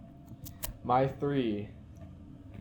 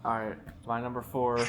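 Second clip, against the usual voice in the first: ordinary talk, nothing marked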